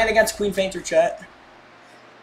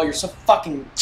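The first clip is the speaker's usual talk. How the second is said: normal talking voice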